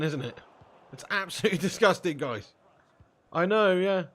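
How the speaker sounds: ordinary talk, nothing marked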